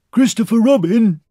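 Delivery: high, loud call of a name. A narrator's reading. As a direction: hail someone far below. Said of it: "he called down to you again".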